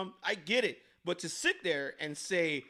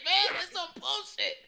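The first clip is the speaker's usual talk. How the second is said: laughing; 7 semitones higher, 9 dB louder than usual. Real, not acted. Laughing rant about European Spanish.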